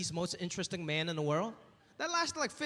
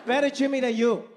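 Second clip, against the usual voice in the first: loud, high scolding mom voice; offended mom; 7 semitones higher, 7 dB louder than usual. Retold or imitated. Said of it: "She was like".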